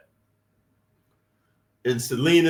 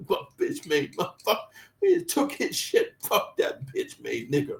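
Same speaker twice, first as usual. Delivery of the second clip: mock him behind his back, laughing; mocking laughing thug; 4 dB quieter than usual. Bloods jeering the older inmate who backed down, sneering mockery.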